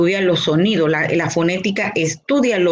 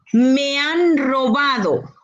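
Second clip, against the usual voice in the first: slow, high cry of "Me han robado"; exclaimed example; 5 semitones higher, slower than usual. Shown as a demonstration. Teacher reading an exclamation example to the class, slow and alarmed. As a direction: call it out like you've just been robbed. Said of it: "Una exclamación".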